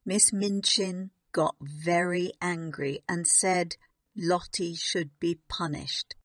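ordinary delivery

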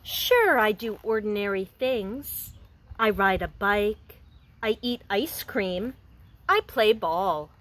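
gentle storybook reading for a class; reading to children. Acted. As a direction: read it like a picture book to young kids. Teacher reads picture book aloud.

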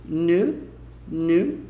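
'new' between noo and nee-oo, lips pursed; pouted in-between vowel. Shown as a demonstration. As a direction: say 'new' with a little pout, vowel halfway to nee-oo. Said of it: "com biquinho".

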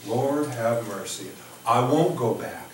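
ordinary talk, nothing marked

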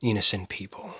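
soft, low echo of the words; quiet disbelief. Acted. Killer echoes the detective's claim, quietly disbelieving. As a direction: repeat it quietly, letting it sit. Repeats a claim before challenging it.

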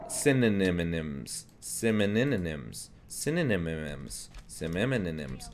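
slow "Synonyms" with extra nim-syllables babbled in; playfully garbled word. Pretend. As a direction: stretch "Synonyms" into a tongue-tangled si-ni-ni-nims, falling at the end. Playfully garbling one word repeatedly.

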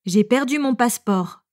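ordinary talk, nothing marked

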